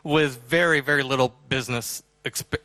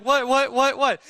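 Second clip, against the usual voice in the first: puzzled surprise; 9 semitones higher than usual. Retold or imitated. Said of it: "Ken's like".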